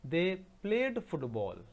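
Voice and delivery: slow dictation. Shown as a demonstration. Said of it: "teacher dictates sentence to class".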